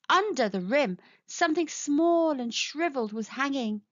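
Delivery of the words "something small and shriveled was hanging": plain reading voice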